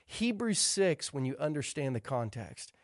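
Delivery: fervent preaching. Acted. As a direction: drive the closing appeal home to the congregation. Closing exhortation at full force.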